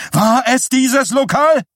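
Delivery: very high and loud, desperate; wife shrieking, terrified for her son; frantic scream. A narrator's reading. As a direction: scream it in panic. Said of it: "schrie Shelley Chatsworth".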